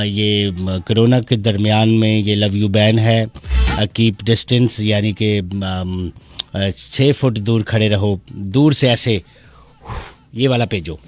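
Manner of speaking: normal talking voice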